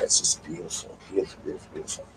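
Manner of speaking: soft whispered awe, dropping away; hushed, fading whisper of admiration; breathy whisper. Real, not acted. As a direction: breathe the words out in wonder. Dreamy praise of Ohio.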